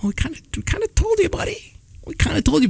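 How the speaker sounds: high, fast, sheepish excuse; sheepish dad. Retold or imitated. Dad sheepishly tells his panicked son they warned him. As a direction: squeak it out like a guilty dad.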